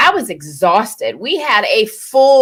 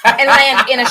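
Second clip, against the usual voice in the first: high, loud laughing panic; laughing mock panic; 8 semitones higher, 8 dB louder, slower than usual. Wife recalling parasailing fear, high laughing panic. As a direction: relive the shark terror, laughing. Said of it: "I was scared to death".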